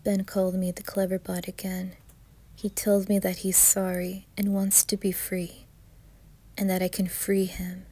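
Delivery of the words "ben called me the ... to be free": ordinary delivery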